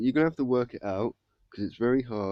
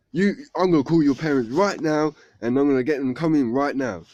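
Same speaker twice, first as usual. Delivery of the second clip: loud, raised-pitch headmaster's threat; mock-angry headmaster; acted telling-off; 4 semitones higher, 6 dB louder than usual. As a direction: lay down the law as an outraged head. Headmaster acted scolding the pupil.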